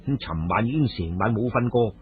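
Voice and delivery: ordinary delivery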